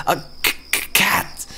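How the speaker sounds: scared whisper. Acted. Cat-phobic man can't say "cat.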